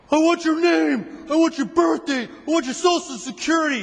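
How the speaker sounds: high, loud barked demands, slowed; a cop yelling at a stranger for his ID; angry cop demands. Retold or imitated. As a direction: bark out each demand like a cop.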